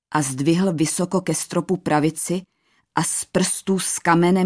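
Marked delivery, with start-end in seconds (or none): none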